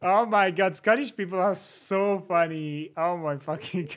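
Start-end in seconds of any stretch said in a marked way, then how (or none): none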